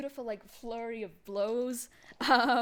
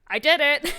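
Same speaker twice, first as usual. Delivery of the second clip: loud, high victory cry; triumphant shout; 5 semitones higher, 12 dB louder than usual. Acted. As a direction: yell it like a proud hero. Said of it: "And he goes".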